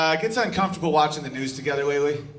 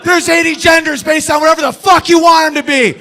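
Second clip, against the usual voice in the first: mock rally shout; 12 semitones higher, 12 dB louder than usual. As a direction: scream it like a riot leader. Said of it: "the T's are like".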